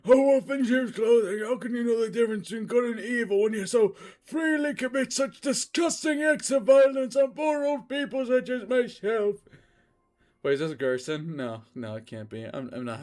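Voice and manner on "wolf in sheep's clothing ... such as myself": put-on high, loud anguished old drunk; high pained cry of an old drunk; drunk old man. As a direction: wail it out like a beaten old drunk. Drunk old man's anguished outcry.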